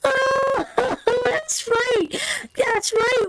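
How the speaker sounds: high, laughing through the words; can barely get it out for laughing. Real, not acted. Wife recalling the saloon trip to husband, laughing.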